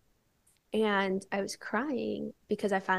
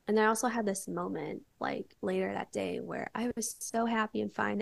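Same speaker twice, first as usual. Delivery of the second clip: ordinary delivery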